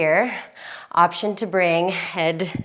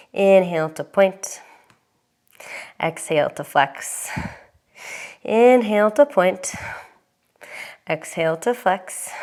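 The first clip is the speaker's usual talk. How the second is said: calm yoga instructor. Acted.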